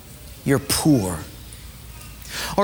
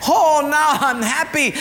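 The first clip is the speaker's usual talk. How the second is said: pleased; 7 semitones higher than usual. Acted. Acted rich gift recipient.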